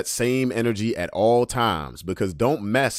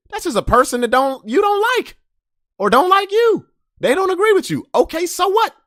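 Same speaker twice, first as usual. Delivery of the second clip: heated rant; 7 dB louder than usual. Real, not acted. Heated rant about enemies.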